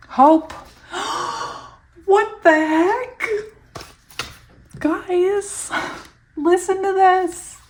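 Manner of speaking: high, loud gasping disbelief; stunned excitement. Real, not acted. Reader to listeners on an uncanny card, stunned. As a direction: blurt it out high and drawn out, amazed. Startled by the card she drew.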